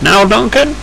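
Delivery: high-pitched, orderly boss voice; Thin Controller, firm. Acted. Controller asking Duncan to show Stanley around, high and firm. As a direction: give instructions in a high put-on voice.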